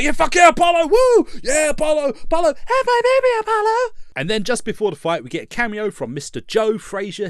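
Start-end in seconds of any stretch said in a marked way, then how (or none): 0.0-3.9 much higher, much louder crowd screaming; hammed-up crowd going wild for Apollo. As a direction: scream like a fan losing it. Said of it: "everybody's like".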